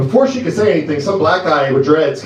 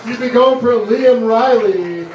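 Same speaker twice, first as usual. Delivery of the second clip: slow, higher emcee call; rally the crowd for the comic; host announcement; 7 semitones higher, 4 dB louder than usual. Real, not acted. Host to audience after the set, slow announcer voice.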